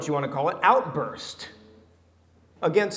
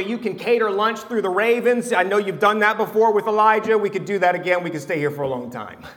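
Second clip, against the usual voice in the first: eager Peter; 5 semitones higher, 7 dB louder than usual. Retold or imitated. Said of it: "why Peter said earlier".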